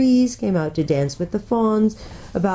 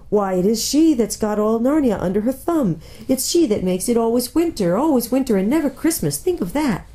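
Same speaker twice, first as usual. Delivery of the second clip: higher, lamenting faun voice; plaintive faun; 5 semitones higher than usual. Acted. Faun explaining the Witch to Lucy, plaintive. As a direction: complain miserably, pitched up.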